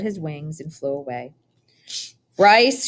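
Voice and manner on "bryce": loud, high shout of a name. A narrator's reading. Old woman shouts at the boy for dawdling by the rabbit. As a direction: yell across the garden. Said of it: "shouted the old woman".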